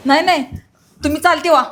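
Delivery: loud, firm get out; furious sister-in-law ordering him out; angry refusal. Acted. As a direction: push him away with your voice. Woman refuses intruder.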